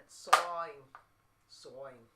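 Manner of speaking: "Sawing" for soaring, R dropped, Boston style; Boston accent. Shown as a demonstration. Musician offering another accent to chat, Boston accent. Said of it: "Or the Boston accent".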